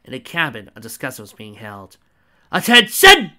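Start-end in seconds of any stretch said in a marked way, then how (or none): 2.5-3.3 very high, loud barked command; barked order. Acted. Lieutenant barking at the sleeping recruit, loud. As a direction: bark it like an officer. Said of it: "a woman barked".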